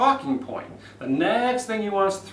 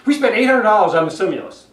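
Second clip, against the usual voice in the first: fast and loud with no shape, stressing We; hurried sentence with the emphasis stuck on We; Santorum's rushed delivery; 6 semitones higher, 9 dB louder, faster than usual. Retold or imitated. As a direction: rattle it off in one rushed breath like Santorum. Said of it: "the way Santorum just said it".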